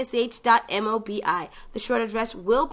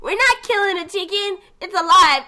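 put-on high, pleading kid voice; mock upset kids; 8 semitones higher, 5 dB louder than usual. Kids begging the others not to kill the chicken, high and pleading.

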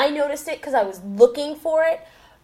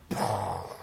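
low mouth-made explosion noise; imitated explosion; 8 dB quieter than usual. Shown as a demonstration. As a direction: make a soft, low blast sound with your mouth. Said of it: "sound effects machine so I go".

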